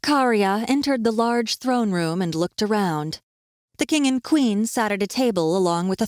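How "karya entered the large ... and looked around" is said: ordinary delivery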